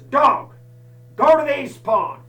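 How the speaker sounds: loud, high call to the dog; commanding shout. Retold or imitated. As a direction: order the dog off across the fields.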